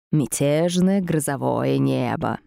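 slow rasping demon voice; hoarse rasp. Acted. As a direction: rasp it out slowly. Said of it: "прохрипел демон".

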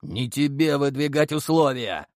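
a hint of a menacing growl; lightly growled threat. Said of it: "прорычал Серый".

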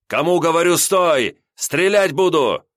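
loud, high, slow threatening yell; furious shout. A narrator's reading. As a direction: bellow the warning to stop. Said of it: "закричал Илья". (грозный крик)

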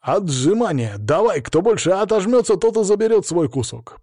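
high-pitched, eager proposal; noisy suggestion. A narrator's reading. Bearded guest proposing a contest for cake, noisily. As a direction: pitch your idea over the crowd. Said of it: "Начались шумные предложения".

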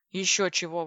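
ordinary delivery

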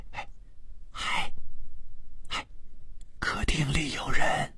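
hushed whisper, barely any voice; soft urgent whisper. A narrator's reading. Gao Wei at sleeping Zheng Chuan's ear, frightened whisper. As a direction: whisper to wake someone without being overheard. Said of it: "伏在他的耳边轻声地说".

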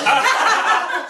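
amused laugh. Acted. Mayor amused by the question.

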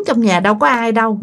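ordinary delivery

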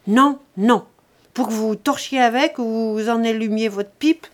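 loud, emphatic double no, then mockery; scornful refusal. A narrator's reading. Son refusing his begging father the papers, scornful. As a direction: shut him down with scorn.

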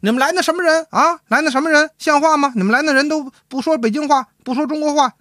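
high-pitched indignant scolding; acted angry wife; wife turning angry. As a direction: play a wife laying into her husband, pitched right up. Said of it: "可跟他翻车了".

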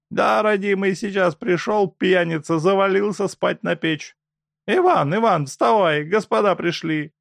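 put-on high, loud fussing old woman; a fussing peasant wife, high and loud. Old woman waking her husband.

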